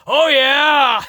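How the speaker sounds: loud, high, whooping shout; Otis. Retold or imitated. Wrestler answering his boss's dream assignment, gleeful mock shout. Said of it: "Otis's response to his boss telling him that".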